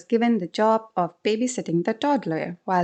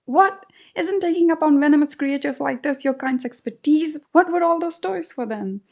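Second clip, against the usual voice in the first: incredulous husband; 7 semitones higher than usual. Acted. Husband retorting to the mongoose, incredulous. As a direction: challenge him in disbelief.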